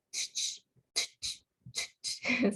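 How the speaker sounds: ch puffed out again and again, breathy and unvoiced; pressure cooker ch. Shown as a demonstration. Teacher imitating the pressure cooker ch. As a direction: hiss ch ch like a whistling pressure cooker. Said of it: "como la pitadora".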